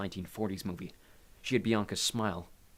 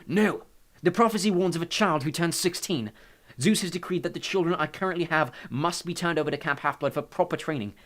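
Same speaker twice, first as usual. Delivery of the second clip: higher, urgent warning voice; agitated Hades; 6 semitones higher, 5 dB louder than usual. A narrator's reading. Hades fears for his children, urgent and agitated. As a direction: lay out the threat urgently.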